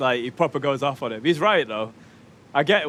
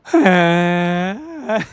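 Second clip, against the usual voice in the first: loud high laugh; 8 semitones higher, 8 dB louder than usual. Real, not acted. Gamer bursts out laughing at the game, loud and high.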